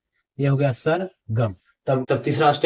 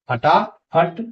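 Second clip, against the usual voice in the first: फटाफट said higher and louder as one word; pronunciation demo; 4 semitones higher, 4 dB louder, much slower than usual. Shown as a demonstration. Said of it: "और वो बोलेंगे फट". (फटाफट को ज़ोर से एक साथ बोलें)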